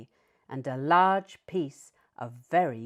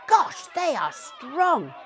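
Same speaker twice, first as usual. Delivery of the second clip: higher, slow, louder awed terrier voice; dog Alfie amazed; 9 semitones higher, 6 dB louder, slower than usual. A narrator's reading. Dog Alfie watching the tug of war, slow and awed. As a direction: gasp it out, impressed. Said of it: "barked Alfie".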